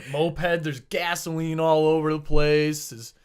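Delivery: usual speaking voice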